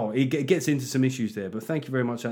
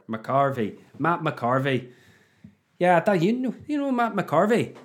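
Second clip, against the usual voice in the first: playful Northern Irish impression, rising higher; hammed-up Northern Irish lilt; Northern Irish accent; 5 semitones higher, slower than usual. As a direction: say it as a chatty Northern Irish local. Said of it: "is that a Northern Irish accent".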